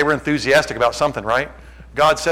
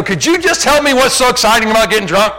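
high, loud incredulous question; loud mock challenge; 9 semitones higher, 8 dB louder than usual. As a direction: demand it at full volume, hammed up.

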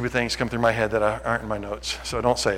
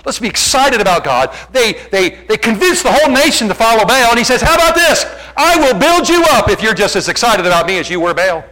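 preach at full force; 9 semitones higher, 8 dB louder than usual. Acted. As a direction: belt it out like a fired-up preacher.

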